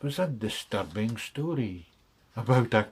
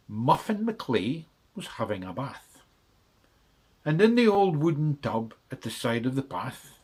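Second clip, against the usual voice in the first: usual speaking voice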